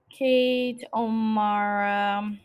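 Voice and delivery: "Kate O'Mara" stretched very slowly; drawn-out pondering. Real, not acted. Trying out the actress's name, "Kate O'Mara" slow and pondering. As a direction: draw out each part of "Kate O'Mara" on a level tone.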